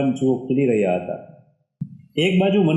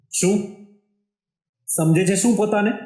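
pretend-angry scolding, raised pitch; mock anger; 5 semitones higher than usual. Akash scolds Manasvi in put-on anger. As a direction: fake a huff at her. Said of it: "ગુસ્સાનો ઢોંગ સાથે".

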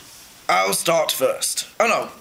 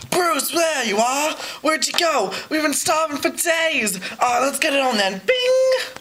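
high, eager fish voice; excited fish voice; 6 semitones higher than usual. Pretend. Acting out the movie scene, high eager fish voice. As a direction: call out like starving fish.

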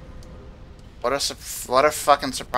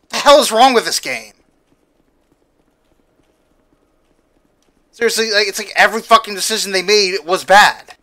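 higher and louder, fed-up rant; angry rant; 6 semitones higher, 6 dB louder than usual. Real, not acted. Player rants to viewers about bad game design, angry and loud. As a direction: vent at the designers.

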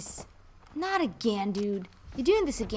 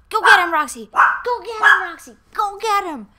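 loud, high rallying cry; egging on; 7 semitones higher, 13 dB louder than usual. Real, not acted. Player sending Roxy after whoever arrived, excited shouting. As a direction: cheer the dog on at full volume.

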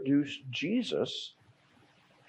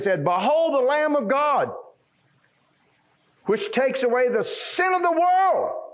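preaching at full force; 11 semitones higher, 4 dB louder than usual. Real, not acted. Preacher crying out to the church at full force. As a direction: proclaim the Lamb of God at full voice.

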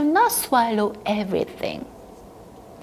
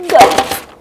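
loud gulp; 5 semitones higher, 8 dB louder than usual. Acted. Acting the swallow.

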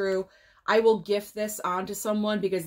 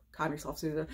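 calming self down; 5 semitones lower, 8 dB quieter, faster than usual. Real, not acted. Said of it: "I can't even talk right now".

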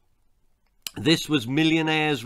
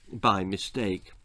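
ordinary delivery

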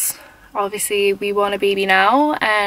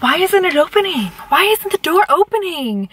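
confused; 8 semitones higher than usual. Retold or imitated. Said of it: "I was like".

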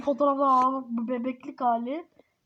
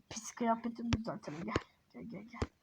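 very soft, voiceless whisper; hushed, barely audible coaxing; 5 semitones lower, 13 dB quieter than usual. Pretend. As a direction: whisper it under your breath. Repeats line under the breath.

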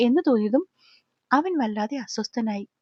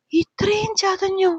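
higher, slow, distressed question; voice breaking; 6 semitones higher, slower than usual. A narrator's reading. Girl seeing his bruise, voice breaking with sorrow. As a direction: draw it out as sorrow catches the voice. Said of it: "സങ്കടം വന്നിട്ട് അവളുടെ ശബ്ദം ഇടയ്ക്കിടെ മുറിഞ്ഞു".

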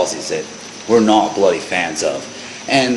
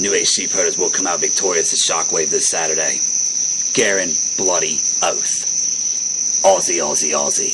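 slow, low, dramatic fight-night promo read; wrestling promo; 3 semitones lower, slower than usual. Acted. Two hosts hyping a tag team match, low dramatic promo. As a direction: build up the match like a trailer voiceover.

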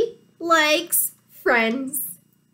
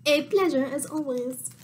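lightly voiced duck, low and softer; quiet, lowered duck voice; duck character; 4 semitones lower, 6 dB quieter, faster than usual. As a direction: repeat it as the duck, pitched down. Duck's line repeated in character.

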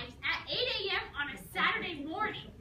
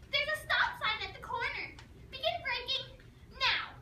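much higher, louder nagging mother; nagging mom voice; 9 semitones higher, 4 dB louder than usual. Retold or imitated. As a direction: imitate mom's drilling lecture voice. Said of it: "she said this in her mom voice".

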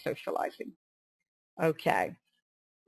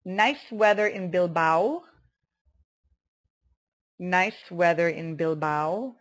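slow, careful dictation with rising ends; slower than usual. Shown as a demonstration. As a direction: read each item out slowly for learners to write. Dictates words and phrases for writing down.

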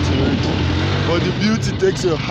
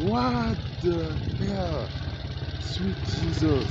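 slow, higher, softer gasp of disbelief; slow and stunned, barely believing it; 4 semitones higher, 5 dB quieter, much slower than usual. Real, not acted. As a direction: let the shock stretch each word out. Stunned at the river crossing.

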